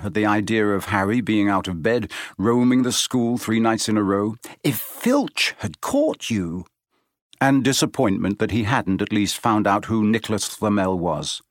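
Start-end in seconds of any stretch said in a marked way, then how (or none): 4.6-6.7 put-on slow scolding girl voice; a hint of a stern schoolgirl; Hermione voice. A narrator's reading.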